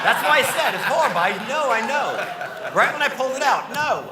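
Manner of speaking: loud and high, giggly protest; laughing defense. Real, not acted. Comic defends the horrible joke, laughing loudly. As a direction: excuse yourself while laughing.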